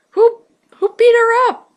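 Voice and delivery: high, loud shocked cry; sudden squeak of shock; shocked outburst. Real, not acted. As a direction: blurt it out in disbelief. Reader shocked by the injuries.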